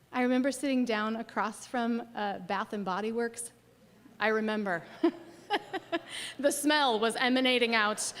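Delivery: normal talking voice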